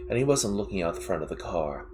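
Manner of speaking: usual speaking voice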